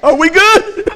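put-on high, loud, bewildered little kid; Thomas. Son checking on his crying dad, mock bewildered. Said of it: "Thomas is going".

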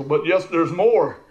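slow, loud ad tagline; commercial pitchman. Retold or imitated. Preacher imitating a commercial to his congregation, pitchman style. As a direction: draw it out loudly like a TV pitch. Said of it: "kinda like that commercial".

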